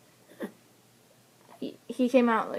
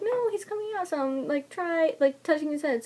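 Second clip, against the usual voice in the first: high, lightly voiced reassurance from staff; encouraging nurse; 6 semitones higher than usual. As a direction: cheer her on like a nurse.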